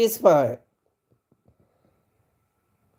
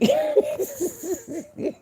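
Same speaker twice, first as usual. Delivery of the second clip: high-pitched laugh; 5 dB quieter than usual. Real, not acted. Host amused at figuring stuff out, laughing.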